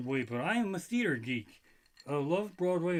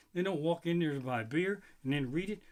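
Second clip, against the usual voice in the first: normal talking voice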